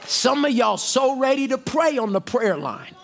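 rising shouted preaching cadence. Acted. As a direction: preach it to the congregation at full force. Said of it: "I'm preaching up here, y'all".

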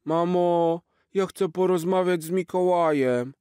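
put-on high, eager child voice; a small boy pleading with his mom; little boy. Little Jaś asking mom to meet Santa, high and eager.

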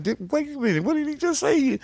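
high, startled double take; surprised. Retold or imitated. Hearing his name in the song, surprised. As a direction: catch yourself in disbelief. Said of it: "I'm like".

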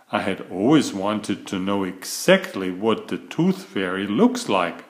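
plain reading voice

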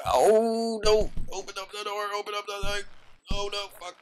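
high, frantic pleading voice; panicked whine. Acted. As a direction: beg in a high panicked wail. Character panics at closed door.

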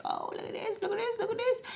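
tiny, high, hushed squeal; excited squeal. Retold or imitated. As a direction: imitate excited browsing in a soft, high squeak.

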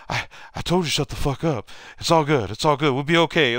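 high-pitched, worked-up mock scolding into reassurance; imitated exasperated teammate. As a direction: mimic a frazzled player on the sideline. Retold winded teammate scolding, then reassuring.

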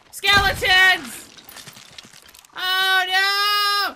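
drawn-out alarmed cry of skeletons; startled shout. Real, not acted. Player spotting skeletons attacking, startled shout. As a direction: yell it as they jump you.

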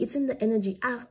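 ordinary talk

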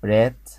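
رات loud, single word with a crisp final t; repeat-after-me model. Shown as a demonstration. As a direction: model رات firmly for the class to copy. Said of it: "انطقها تاني ورايا".

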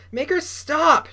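high, loud demanding shout. A narrator's reading. Bully orders Noah to stop his sister, demanding. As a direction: demand it hard, pitch jumping up. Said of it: "Jasper Junior demanded".

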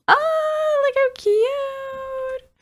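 very high, slow squeal; squealing coo. Real, not acted. Player gushing at the pixel couple, very high slow squeal. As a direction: squeak it out, overwhelmed by cuteness.

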